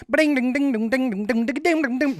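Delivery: high, fast plucked banjo noises; banjo imitation. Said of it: "the banjo player's going".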